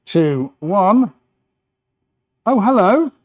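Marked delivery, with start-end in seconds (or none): 2.4-3.1 high, loud feigned surprise; mock surprise. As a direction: act amazed, playfully overdone. Mock surprise at platform starting to spin.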